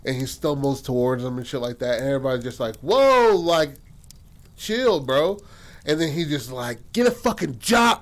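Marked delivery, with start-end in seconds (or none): 2.8-5.4 high, loud imitated "whoa" easing off; alarmed protest. Onlookers alarmed, telling him to stop. 6.9-8.0 high-pitched imitated outburst; mock angry jeer. Zach snapping at the bum.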